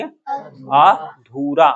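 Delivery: अधूरा split up slowly: "aa... dhooo-raa"; long vowel. Shown as a demonstration. Teacher asking what we will say, अधूरा very slow with long ऊ. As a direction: stretch अधूरा syllable by syllable, long ऊ. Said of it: "तो बड़ी ऊ की मात्रा आएगा".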